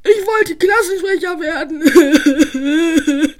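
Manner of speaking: slow, mock-sobbing wail; hammed-up crying over losing. Spike loses the election and wails in mock tears. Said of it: "Spike heulte".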